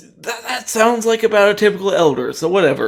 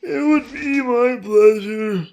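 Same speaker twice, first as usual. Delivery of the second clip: put-on slow, high, quavering old man; lightly voiced old man; 6 semitones higher, slower than usual. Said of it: "This old man voice does not fit".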